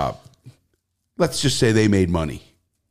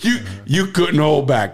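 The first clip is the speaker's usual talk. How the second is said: laughing; 5 semitones higher than usual. Real, not acted. Teasing host who laughed.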